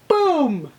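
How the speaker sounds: loud boom falling from high; fireworks boom. Acted. Teacher suggesting summer fireworks, loud high falling boom. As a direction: explode it like a firework. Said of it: "Like fireworks".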